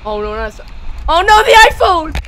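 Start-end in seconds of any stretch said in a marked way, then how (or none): 1.1-2.2 loud high oh no; alarmed cry. Real, not acted. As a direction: shout in alarm. Phone flies in clip.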